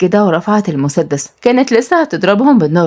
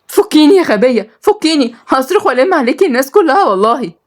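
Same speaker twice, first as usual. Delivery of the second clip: higher pitched, demanding to be untied; captive woman's defiance; 7 semitones higher than usual. Acted. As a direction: fight back against the captor with a raised voice. Nour tied, demanding release.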